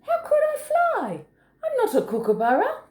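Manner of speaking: very high, incredulous little wallaby; protesting Joey. A narrator's reading. Joey objecting to Kookaburra's challenge, high-pitched protest. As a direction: squeal the objection as a small animal.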